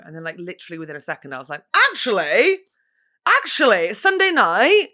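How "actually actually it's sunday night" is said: acted change of mind.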